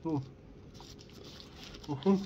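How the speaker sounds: chewing while talking; speaking between bites of sandwich; mouth full of food. Real, not acted. Seen 2: Holds sandwich, bites it at 1.9-3.0, mouth chewing throughout.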